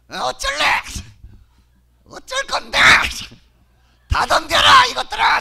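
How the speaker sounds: cocky street taunt; brazen challenge. Acted. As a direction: dare the whole room to fight. Shows off her brazen attitude.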